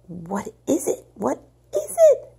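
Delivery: rising to a shrill panicked cry; gasped in panic. Acted. Mother hearing the wailing, rising panicked gasp. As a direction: gasp it out, terror building. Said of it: "Missus Lennox gasped".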